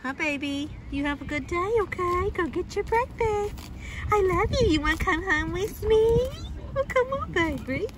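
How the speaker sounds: high-pitched, coaxing affection. Real, not acted. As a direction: coo sweetly to a pet. Cooing to an animal.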